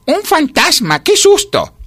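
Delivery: very high, loud alarm; hammed-up storybook fright; frightened cry. A narrator's reading. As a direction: cry out as if seeing a ghost. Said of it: "gritó".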